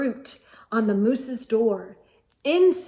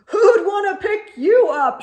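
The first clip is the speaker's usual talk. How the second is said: loud, high teasing laugh; mocking laugh; 10 semitones higher, 9 dB louder than usual. A narrator's reading. Sheep mocks porcupine, loud laughing. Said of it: "laughed his bleat of a laugh".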